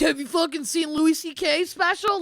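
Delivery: high, loud mock retort; acted comeback. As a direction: shout back like answering a bully. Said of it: "I'd be like".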